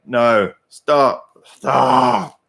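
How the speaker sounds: fed-up, drawn-out plea; exasperated plea. Acted. Exasperated at repeated incoming messages.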